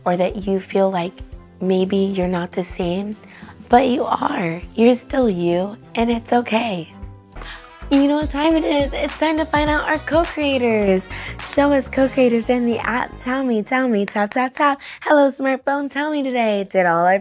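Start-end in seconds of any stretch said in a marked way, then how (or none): none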